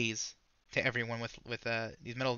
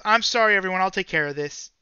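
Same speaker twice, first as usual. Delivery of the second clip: loud, raised-pitch public apology; yelling; 5 semitones higher, 9 dB louder than usual. Acted. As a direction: call out over a whole crowd. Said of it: "and yells".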